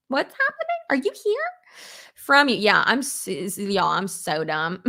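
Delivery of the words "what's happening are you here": very high-pitched, puzzled questioning; confused squeak. Retold or imitated. Vlogger retelling her confusion to a viewer, high confused squeak. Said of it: "I was like".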